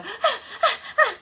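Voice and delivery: high, loud frightened yelps reenacted; panicked yelps. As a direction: yelp like you just saw a snake. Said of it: "all I could do was jump up and down and go".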